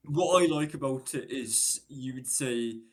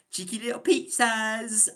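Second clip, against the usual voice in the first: high, loud, hammed-up advert voice; mock the cheesy TV ad you hate; a sing-song parody of a pizza ad; mocking Domino's advert; 9 semitones higher, 5 dB louder than usual. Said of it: "an advert for Domino's here".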